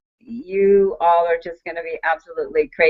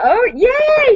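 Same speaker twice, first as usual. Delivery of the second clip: squealed high "yay"; husband's happy squeal; excited yay; 4 dB louder than usual. Retold or imitated. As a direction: mimic his delighted cheer. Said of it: "He was all excited".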